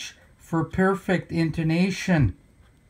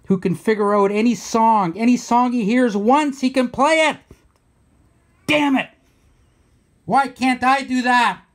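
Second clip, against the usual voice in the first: raised, higher voice building in frustration; worked-up, louder-pitched griping; exasperated rant; 5 semitones higher, 3 dB louder than usual. Real, not acted. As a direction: burst out in envious frustration. Mock rant envying Rick's talent.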